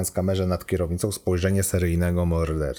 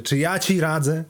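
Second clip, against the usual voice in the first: repeated question pushed much higher; heightened rhetorical echo; 9 semitones higher, 3 dB louder than usual. Acted. Computer repeats the student's question, heightened and rhetorical. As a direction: throw it back harder, pitched up.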